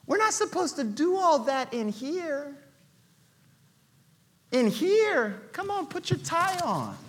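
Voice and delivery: soft, condescending scolding; hushed, prim and superior; smug church insider. Acted. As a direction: talk down to him like he's embarrassing. Esther voiced as smug insider.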